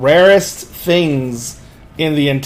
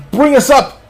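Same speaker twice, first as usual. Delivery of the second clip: high, raised command voice; captain shouting orders; 8 semitones higher, 4 dB louder than usual. Acted. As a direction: call orders across a ship's deck.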